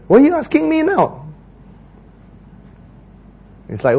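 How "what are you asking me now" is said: high, exasperated scolding voice; the Lord scolding Moses, pitched up; God scolding, high and exasperated. Acted. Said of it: "The Lord says".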